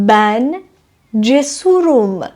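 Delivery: cesurum stretched out very slowly; slow model sentence. Shown as a demonstration. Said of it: "مثل". (cesurum را آهسته و کشیده بگو)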